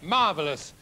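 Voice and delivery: ordinary talk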